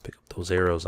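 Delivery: ordinary delivery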